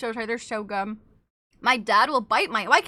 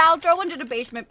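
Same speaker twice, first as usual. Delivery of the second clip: ordinary talk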